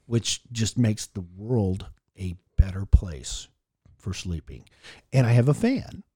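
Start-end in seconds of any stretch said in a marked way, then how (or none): 0.1-4.6 whispering. Acted. Dreamy praise of ceiling fan.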